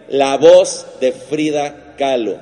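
ordinary talk, nothing marked

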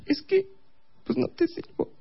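put-on very high, soft sulky girlfriend; sulking girlfriend. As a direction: mutter it high like a pouting girlfriend.

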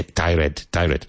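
"tired" split into two syllables, "tie-red"; students' mistaken pronunciation. Shown as a demonstration. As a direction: mimic learners saying "tired" as tie-RED, with a clear "red". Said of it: "So many students say".

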